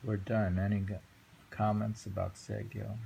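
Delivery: ordinary talk, nothing marked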